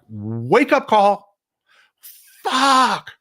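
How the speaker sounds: high, drawn-out mock shock at the wake-up call; mock dawning alarm. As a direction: play a European finally waking up with a jolt. Europeans voiced realizing the danger.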